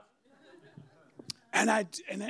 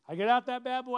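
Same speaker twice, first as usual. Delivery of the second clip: ordinary talk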